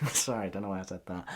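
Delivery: ordinary delivery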